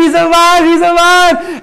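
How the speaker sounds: high, loud joyful cry; celebrating. Acted. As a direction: shout the good news. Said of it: "everyone's celebrating".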